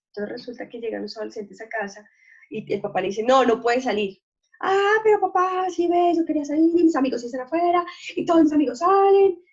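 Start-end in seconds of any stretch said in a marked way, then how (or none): 3.2-4.2 stern father. Acted. Father refusing teen going out. 4.6-9.4 put-on whiny teenager. Teen begging dad to let him go out, high loud whine.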